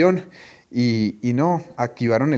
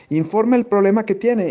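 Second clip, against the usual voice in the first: controller question; 7 semitones higher than usual. Retold or imitated. Controller asking the problem.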